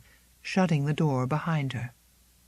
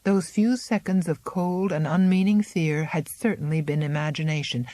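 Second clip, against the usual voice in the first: plain reading voice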